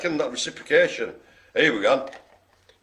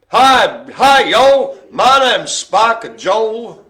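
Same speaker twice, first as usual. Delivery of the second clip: loud, high, drawn-out mock Southern accent; Spiky Joe, American Southern accent; 7 semitones higher, 10 dB louder, much slower than usual. Spiky Joe introduces himself, hammed-up Southern accent. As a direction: ham up a Louisiana drawl. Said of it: "says Spiky Joe".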